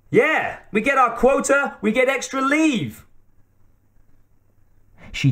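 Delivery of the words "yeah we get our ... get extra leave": put-on very high-pitched, babyish chiming in; babyish heckler. A narrator's reading. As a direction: pipe up in a high, silly voice. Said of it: "a veiled voice in baby talk".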